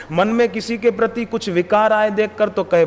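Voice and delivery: ordinary talk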